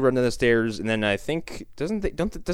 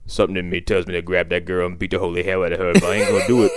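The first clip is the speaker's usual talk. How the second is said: Joe; 6 semitones lower than usual. Retold or imitated. Retelling Joe's line to co-hosts, low character voice. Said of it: "Joe goes".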